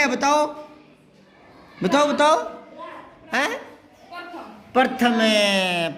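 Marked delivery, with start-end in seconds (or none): 4.7-5.9 प्रथम said slowly, syllable by syllable; slow careful root word. Shown as a demonstration. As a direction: spell out प्रथम slowly for the class. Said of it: "प्रथम से बना है". (धीमे और साफ़ प्रथम)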